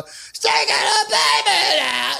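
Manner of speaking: high raspy rock scream, slow; impression of a wrecked rock yell; shredded-throat scream. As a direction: scream it like a singer with a torn throat. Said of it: "[name]'s throat was shredded and he's all".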